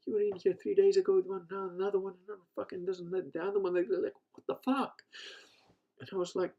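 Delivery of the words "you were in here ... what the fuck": higher-pitched, incredulous retelling; mock-baffled rant; imitated disbelief. Deli guy marvelling at yet another date, incredulous.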